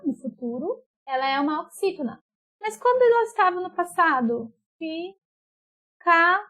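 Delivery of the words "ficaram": "Ficarão" as past-tense fi-CA-ram, very slow separate syllables; stress on CA. Shown as a demonstration. Teacher showing pupils the past tense, "Ficarão" split with CA stressed. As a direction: sound out "Ficarão" with CA strong, pauses between syllables. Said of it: "É o K".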